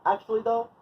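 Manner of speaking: high chant for others to repeat; demonstrated call-out. Shown as a demonstration. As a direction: pitch it high and hang on the last word. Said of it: "say it eight times".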